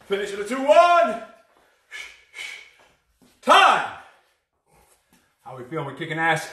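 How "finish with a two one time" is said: rising to a high, loud finish; trainer's final countdown call; calling time. Real, not acted. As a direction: shout the last count to end the set. Calling the end of the set.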